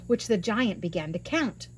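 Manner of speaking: usual speaking voice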